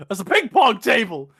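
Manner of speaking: loud, exclaimed retold line; imitated alarmed outburst; mock yell. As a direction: shout it as the film character. Acted shout of the quote.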